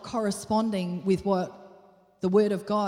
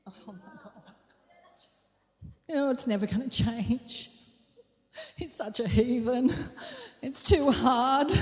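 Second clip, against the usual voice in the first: mock exasperation; 3 semitones higher than usual. Wife at home about her husband, mock exasperated moan. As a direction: moan it, then whine it out. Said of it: "And then we go".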